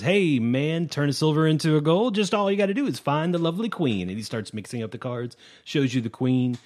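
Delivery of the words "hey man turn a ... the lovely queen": hustler gnome. Acted. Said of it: "the gnome just looks up at you and goes".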